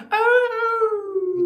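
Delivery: high, drawn-out mock ritual wail; mock scream. Streamer mimicking the ceremony crowd, mock screaming. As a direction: wail it loud and high like a cultist. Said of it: "they start screaming".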